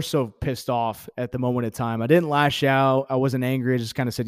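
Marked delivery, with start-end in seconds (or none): none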